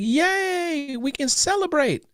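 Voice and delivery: excited wife. Retold or imitated. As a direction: squeal it like a wife who got good news. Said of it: "She's like".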